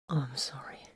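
soft breathy whisper of regret; whispered apology. A narrator's reading. Titus to wounded Vox, whispered apology. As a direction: breathe the apology into her hair. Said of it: "Titus said".

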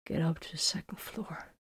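faint, strained whisper; weak, barely audible whisper; hardly able to speak. A narrator's reading. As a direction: whisper it with almost no strength. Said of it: "sounded like he could hardly speak".

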